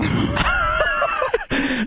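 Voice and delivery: high pained laugh; shrieking laugh. Real, not acted. Burning pepper, loud high laugh.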